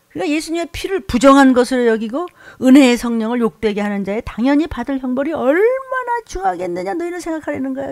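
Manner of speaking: loud, driving pulpit shout; preaching at full force. Acted. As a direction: hammer the warning home loud. (강단에서 크게 몰아치는 설교)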